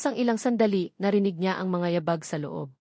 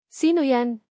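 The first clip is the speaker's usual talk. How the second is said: wary call through the door; 4 semitones higher, 4 dB louder, slower than usual. Acted. Knock at night, calling out.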